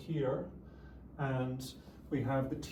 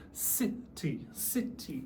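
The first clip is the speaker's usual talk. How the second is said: "City" said slowly, split into two syllables; slower than usual. Shown as a demonstration. Teacher showing a student how to pronounce a word, very slowly. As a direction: say "City" syllable by syllable, sit-tee. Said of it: "teacher would say very slowly".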